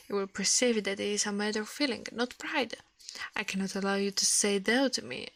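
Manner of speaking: ordinary delivery, nothing marked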